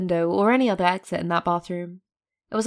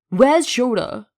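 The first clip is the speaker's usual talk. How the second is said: loud, higher angry demand; enraged; 5 semitones higher, 7 dB louder, much slower than usual. A narrator's reading. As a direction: demand it furiously. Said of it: "voice filled with rage".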